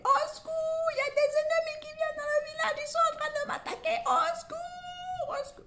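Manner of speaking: very high, fast fake screaming boy; hammed-up boy screaming he's attacked; fake cries for help. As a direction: cry for help like a faking kid. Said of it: "Il se met à hurler".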